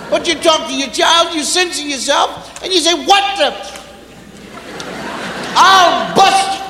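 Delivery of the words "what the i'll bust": sputtering angry parent. Retold or imitated. Censored parent threat.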